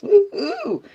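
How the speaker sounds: very high, playful woohoo; high celebratory whoop; party whoop. Real, not acted. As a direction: squeal out a giddy cheer. Whooping after joking about strange vibes.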